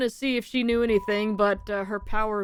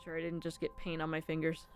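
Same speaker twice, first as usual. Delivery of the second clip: normal talking voice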